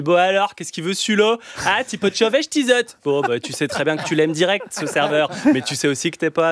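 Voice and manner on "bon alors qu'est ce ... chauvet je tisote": put-on higher-pitched Ch'ti accent from Arras; Ch'ti waiter. Waiter in Arras greeting a customer, higher Ch'ti accent. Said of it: "qui débarque à Arras".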